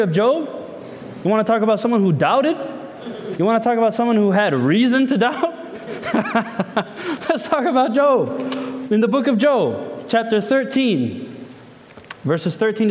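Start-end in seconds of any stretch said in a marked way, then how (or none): 5.6-7.2 soft, spontaneous chuckling; quiet laugh breaking out between phrases. Real, not acted. Preacher amused after asking about reason to doubt, laughing.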